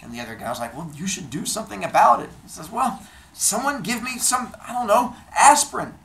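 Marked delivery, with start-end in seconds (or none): 0.8-2.3 higher, louder quick retort; exhortation. Acted. Other guy's acted retort. 2.7-5.9 high-pitched, louder outburst; exasperated demand. Acted. Acted demand for headache relief.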